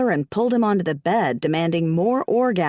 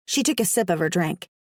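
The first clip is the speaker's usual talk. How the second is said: ordinary delivery, nothing marked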